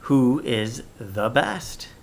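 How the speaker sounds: slow question rising on best; asking tone. Shown as a demonstration. Teacher modelling a question for pupils, rising. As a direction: ask it, curious and slow. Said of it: "we are asking a question".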